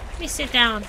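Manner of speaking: ordinary talk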